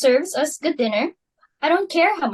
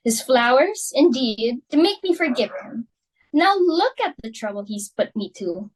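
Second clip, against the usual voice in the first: normal talking voice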